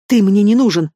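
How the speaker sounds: put-on furious outburst of a wronged girl; angry shout. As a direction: hurl it at him in fury.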